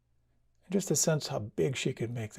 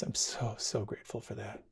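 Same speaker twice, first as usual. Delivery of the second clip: much softer, barely voiced; quietly touched by thanks; softly moved; 2 semitones lower, 7 dB quieter than usual. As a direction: let the gratitude hush you. Mother moved by gratitude.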